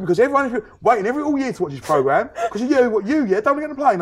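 mock minder lecturing him; mock Tanya voice. Tanya voiced pleading in story.

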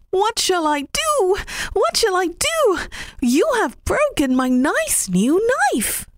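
high, rising wail of dismay; distressed rabbit. Acted. Rabbit lamenting his broken knife to old man, high and distressed. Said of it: "cried Mister Rabbit".

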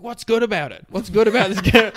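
high, loud mock interrogation; grilling. Crazed man grilling girl about her cafe job, loudly. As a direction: imitate a pushy man demanding answers. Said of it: "really grilling her".

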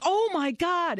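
retold alarm. Daughter walking in on the kitchen fire, mock-alarmed cry. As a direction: imitate a startled daughter.

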